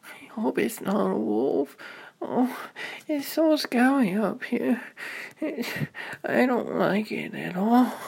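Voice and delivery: put-on high, anxious child voice; scared little girl. Little sister alone in the dark, high and anxious. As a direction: fret like a frightened kid. Ruby hears noise, scared.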